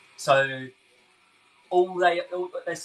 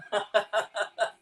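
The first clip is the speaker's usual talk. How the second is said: high, amused burst of laughter; real laugh; 7 semitones higher than usual. Real, not acted. [name] hears Agnes's relationship joke, laughing.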